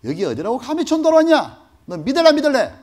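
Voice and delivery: loud, high furious shouting; gunman's threat. Retold or imitated. Gunman demanding he renounce Jesus, furious shout. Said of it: "저한테 그러는 거야".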